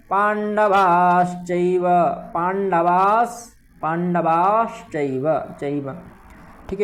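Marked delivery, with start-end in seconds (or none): 0.1-5.3 पांडवाश्चैव slowly with श before च; clear sha. Shown as a demonstration. Teacher drilling the श in पांडवाश्चैव. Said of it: "शा है ये वाला".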